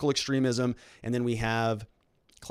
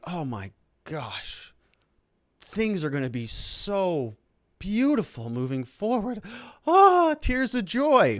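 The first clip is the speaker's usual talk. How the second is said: mock sentimental swoon; 7 semitones higher, slower than usual. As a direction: ham up a gushing fan, overcome with joy. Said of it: "And they think".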